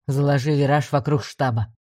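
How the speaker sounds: low, firm commander; commanding order. A narrator's reading. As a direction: give a calm low order. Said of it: "приказал командор".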